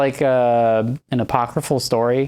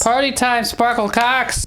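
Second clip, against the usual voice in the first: movie quote mimic; 11 semitones higher than usual. Echoing the movie line.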